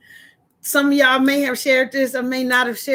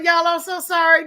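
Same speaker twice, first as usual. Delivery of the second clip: ordinary delivery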